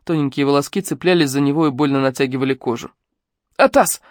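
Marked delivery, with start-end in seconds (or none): 3.6-4.0 alarmed hiss. A narrator's reading. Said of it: "настороженно прошипел".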